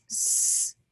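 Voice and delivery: "S-L-I" as long separate sss, lll, eye; sounded out. Shown as a demonstration. Teacher dictating as pupils write, "S-L-I" slowly sounded out. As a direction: hold each sound of "S-L-I" with gaps between. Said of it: "Let's write it".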